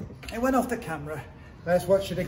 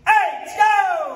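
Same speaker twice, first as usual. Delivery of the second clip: big shouted count, sharply high; loud shout; 7 semitones higher, 5 dB louder than usual. Shown as a demonstration. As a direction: shout the number out. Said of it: "this time with a loud shout".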